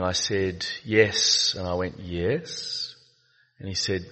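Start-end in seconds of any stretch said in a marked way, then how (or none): 2.0-2.9 a softer, hesitant Yes that gives the game away; reluctant yes. Retold or imitated. Retelling how his guilty Yes sounded, softly hesitant. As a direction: let the Yes sound like not really. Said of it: "By the intonation of your voice".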